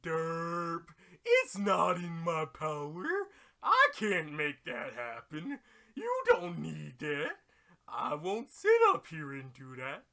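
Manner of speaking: loud, slow, dopey dragon refusing; dumb dragon voice. Pretend. Shenron refusing Dende's wish, loud and slow dopey voice. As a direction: play a lumbering derpy dragon. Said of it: "the dragon he makes going".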